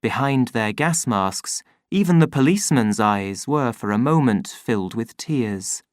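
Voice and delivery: ordinary delivery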